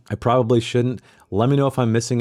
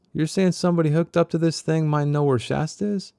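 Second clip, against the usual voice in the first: pitched-up Doc voice; higher character voice; 8 semitones higher than usual. Acted. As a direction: lift it into Doc's voice. Doc voiced in read novel.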